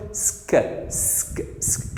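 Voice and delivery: "سك" as voiceless S running into K; SK blend. Shown as a demonstration. Teacher showing S before C, isolated voiceless sk sound. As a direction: hiss the S of "سك", then click the K. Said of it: "شفت؟".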